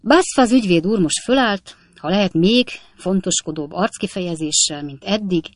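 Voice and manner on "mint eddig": usual speaking voice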